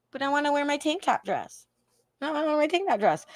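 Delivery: whiny mock plea. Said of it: "I was like".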